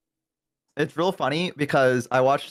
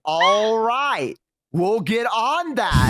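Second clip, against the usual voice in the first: slow, high-pitched clerk sign-off; imitated Australian clerk; 8 semitones higher, much slower than usual. Flower shop clerk confirming delivery, slow imitated voice. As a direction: draw it out in a higher put-on voice.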